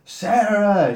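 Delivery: high, drawn-out mock call; acted shout of a name; imitated calling out. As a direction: call her name as if searching the room. Acts out man calling her name.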